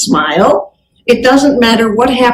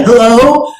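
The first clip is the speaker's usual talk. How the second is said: bare phone hello. Shown as a demonstration. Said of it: "Some just say".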